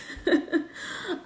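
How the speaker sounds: high, breathy burst of laughter. Real, not acted. Author reacting to fans asking about the show, laughing. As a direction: laugh helplessly at the familiar question.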